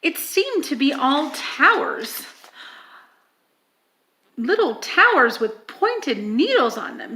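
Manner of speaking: ordinary delivery, nothing marked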